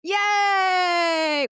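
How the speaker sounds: sustained happy squeal of triumph; playful cheer. Real, not acted. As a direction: celebrate with a drawn-out high whoop. Cheers on being praised.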